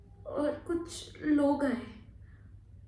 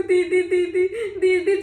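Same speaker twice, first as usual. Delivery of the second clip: loud, raised cries of a frightened brother; screaming for sister; 4 semitones higher, 9 dB louder than usual. Acted. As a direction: cry out repeatedly for your sister. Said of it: "चिल्ला ही जा रहा था". (चीखकर दीदी को पुकारना)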